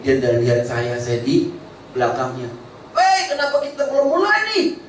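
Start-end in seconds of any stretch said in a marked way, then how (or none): 2.9-4.8 a high, impatient call from the back; lightly voiced classmate; classmate's question. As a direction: schoolmate asking why they wait, pitched high. Classmate asks at reunion.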